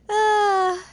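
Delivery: loud, high voiced sigh after laughing; amused sigh. Real, not acted. Reader recovering from laughing at Chong Yun, amused sigh.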